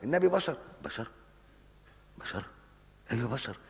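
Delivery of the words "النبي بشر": high-pitched, louder mimicry of a dismissive skeptic; mock the one who says the Prophet is just human; mocking quote. Preacher mimicking a skeptic to his congregation, high mocking voice. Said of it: "تقولش".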